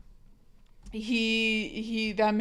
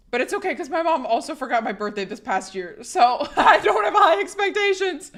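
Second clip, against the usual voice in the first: laughing it off; 7 semitones higher, 6 dB louder than usual. Real, not acted. Streamer joking that mom forgot her birthday, laughing. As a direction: voice climbing high and loud into laughter.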